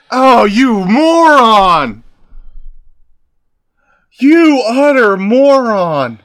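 loud, slow, exasperated shout; exasperated yelling. Real, not acted. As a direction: yell at the screen in disbelief. Yelling at the game character.

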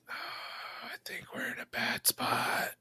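slow, hushed whisper after a sigh; worried whisper. Acted. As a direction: whisper it slowly under your breath. Aside whispered in tense scene.

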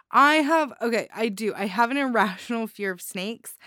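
ordinary talk, nothing marked

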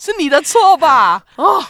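indignant mock; sarcastic echo; mocking outrage. Real, not acted. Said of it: "mocking echo".

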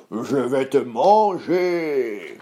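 slow, drawn-out troll roar; menacing troll, very slow; howling troll. Acted. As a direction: stretch the threat out like a hungry monster. Troll threatens Moyen Bouc.

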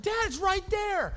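high, slow, far whine; whiny daughter. Retold or imitated. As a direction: play the annoyed little girl. Daughter voiced in story.

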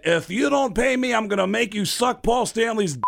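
high, forceful mock threat. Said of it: "if you called him as [name]".

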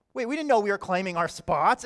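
loud, aggrieved protest; upset. Acted. Disciples who missed out on the best spots, upset and protesting. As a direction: object loudly, as if cheated. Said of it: "they get upset 'cause this isn't fair".

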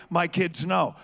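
ordinary delivery

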